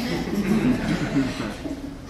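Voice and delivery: a short, soft laugh. Acted. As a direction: chuckle quietly to yourself. Amused aside about postpartum women.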